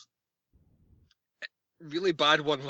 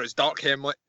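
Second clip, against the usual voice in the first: ordinary talk, nothing marked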